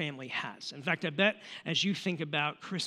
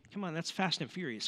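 ordinary talk

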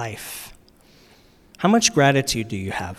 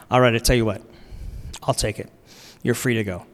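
lower, softer, kindly forgiving; gentle king; 5 semitones lower, 4 dB quieter, faster than usual. Retold or imitated. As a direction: let him off gently, like a generous king.

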